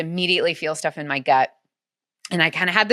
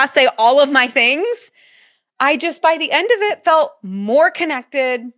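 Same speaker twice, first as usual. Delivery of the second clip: higher, slowed, laughing while she speaks; laughing through words; 7 semitones higher, 4 dB louder than usual. Real, not acted. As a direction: let the laugh carry the words, slow and high. Laughing through emotional ending.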